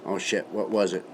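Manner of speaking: ordinary talk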